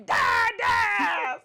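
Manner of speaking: high, loud demanding yell of Dad, dad; aggressive toddler shout. Retold or imitated. Toddler always calling his dad, loud aggressive "Dad, dad!". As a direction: bellow "Dad, dad!" like a rough-playing toddler. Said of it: "he's so aggressive".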